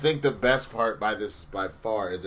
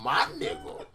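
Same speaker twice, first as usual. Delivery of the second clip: delirious laugh; 2 semitones higher, 5 dB quieter than usual. Real, not acted. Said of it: "I think I'm getting delirious".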